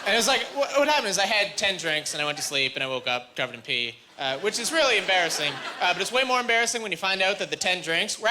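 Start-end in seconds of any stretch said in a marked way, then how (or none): none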